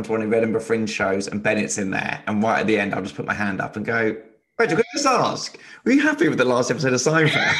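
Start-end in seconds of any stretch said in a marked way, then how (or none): 4.6-7.5 high-pitched teasing questioner, laugh creeping in; mock audience question. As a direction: play a cheeky audience member, near laughter. Imagines heckling with the same question.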